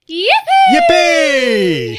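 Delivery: loud, high gleeful yippee; cheering with the class after three. Real, not acted. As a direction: throw out a joyful yippee. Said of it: "we're gonna go "yippee".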